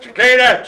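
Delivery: "Cicada" said chi-KAY-da, loud and high; alternative pronunciation. Shown as a demonstration. Host retrying the word, "Cicada" as chi-KAY-da. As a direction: punch out "Cicada" with an AY in the middle. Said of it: "I can't remember how to pronounce it".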